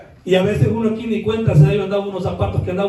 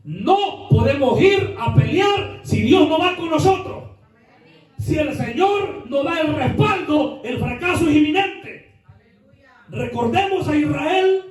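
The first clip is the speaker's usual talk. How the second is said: higher, slow, insistent preaching; 7 semitones higher, slower than usual. Acted. Preacher warning the church they need God's backing, fervent preaching. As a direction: press each word like a warning from the pulpit.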